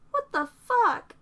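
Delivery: stunned slow exclamation; stunned outburst. A narrator's reading. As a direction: drag it out in disbelief.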